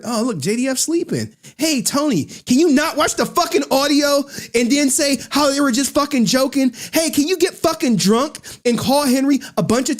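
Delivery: mock him pleading for everyone to vouch; sneering high-pitched impression of a needy guy; mocking Aaron.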